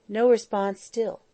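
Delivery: plain reading voice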